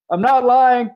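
high, loud, hammed-up protest; retold insistent call. Homeless man as they're about to drive away, imitated insistent call.

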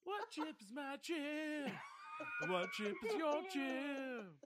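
drawn out. Pretend. Slow drawn-out playful repetition.

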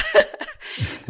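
sudden high delighted laugh; unvoiced high laugh of delight; burst of high laughter. Real, not acted. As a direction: let a giddy laugh burst out. Laughs at the free degree.

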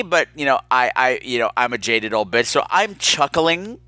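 ordinary delivery

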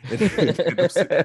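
high, loud laugh through the words. Real, not acted. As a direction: laugh while speaking. Laughing while telling story.

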